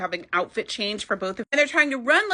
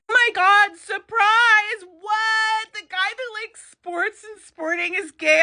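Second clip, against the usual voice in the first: sarcastic shocked squeal, much higher and louder; mock surprise; 10 semitones higher, 4 dB louder, much slower than usual. Vlogger mocking the obvious plot twist with fake shock. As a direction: ham up fake astonishment.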